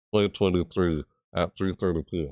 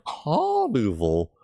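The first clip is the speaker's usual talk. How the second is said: crow caw; 6 semitones higher, much slower than usual. Acted. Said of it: "that's why I do it".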